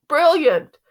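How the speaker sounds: high, loud, admiring echo; excited praise. A narrator's reading. Mom nodding along with Dad, excited praise.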